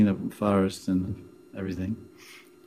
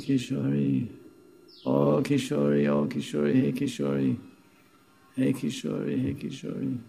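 calling out for wife; 4 semitones higher, 8 dB quieter than usual. Retold or imitated. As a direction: call her name longingly, softly, as if wandering lost. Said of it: "he was always calling out for the name of his wife".

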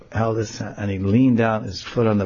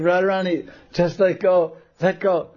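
coaxing Krishna; 9 semitones higher than usual. Acted. Krishna coaxes Radha.

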